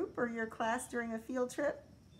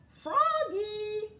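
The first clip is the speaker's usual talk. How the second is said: high, loud call; teacher calling Froggy back to the group; 13 semitones higher, 8 dB louder than usual. A narrator's reading. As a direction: call him back across the patch. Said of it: "called his teacher".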